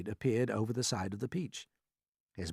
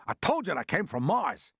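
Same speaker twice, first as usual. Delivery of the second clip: higher, insistent I-told-you-so; calling out; 6 semitones higher, 4 dB louder than usual. A narrator's reading. Police chief claiming he was right, calling out. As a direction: call it out to prove your point. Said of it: "called the chief of police".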